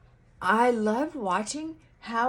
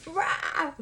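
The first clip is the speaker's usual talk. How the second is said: mock angry roar; 9 semitones higher than usual. Teen outburst.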